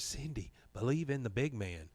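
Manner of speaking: mock whispered reassurance, kept quiet; hushed near-whisper. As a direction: murmur it privately to her. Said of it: "Bill kinda whispers and says".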